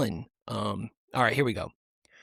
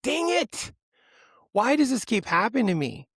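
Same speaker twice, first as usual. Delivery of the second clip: high-pitched exasperated whine; exasperated outburst at own mistake; mad about it; 9 semitones higher than usual. Real, not acted. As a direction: complain in comic frustration, voice pitched way up. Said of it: "So mad about it".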